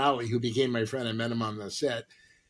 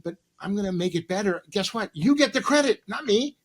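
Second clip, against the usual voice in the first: rising excited plea; 8 semitones higher, 4 dB louder than usual. Retold or imitated. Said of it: "I said".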